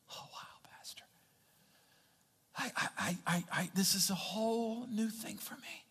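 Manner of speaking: soft, halting near-whisper, acted; hushed awe. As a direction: stammer softly as an overwhelmed churchgoer. Acting out an overwhelmed congregant to pastor.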